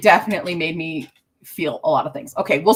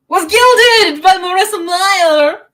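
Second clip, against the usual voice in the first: excited announcement; 10 semitones higher, 7 dB louder, slower than usual. Real, not acted. Announces the best fantasy book.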